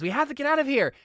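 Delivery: high-pitched urgent shout; really loud. Acted. Deafened woman urging companion to flee, really loud. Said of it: "really loudly says".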